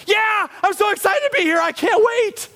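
very high, hammed-up squeal of joy; over-the-top childlike eagerness; excited shout. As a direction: shout it like a thrilled little kid. Acting eager worshipper greeting God.

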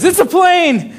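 mock excited bystander. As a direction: point at the sky in mock awe. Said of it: "a woman says".

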